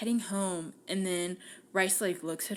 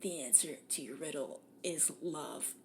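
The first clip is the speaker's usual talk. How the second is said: solemn hushed answer; 6 semitones lower, 12 dB quieter than usual. Retold or imitated. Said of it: "She's like".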